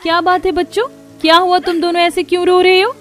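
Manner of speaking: high-pitched, concerned questioning; worried mother. Acted. Mother asking her children why they cry, worried. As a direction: ask anxiously, voice lifted high.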